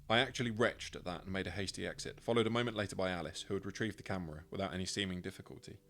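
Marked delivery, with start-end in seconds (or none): none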